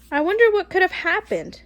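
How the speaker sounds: ordinary delivery, nothing marked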